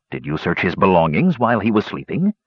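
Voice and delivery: ordinary delivery, nothing marked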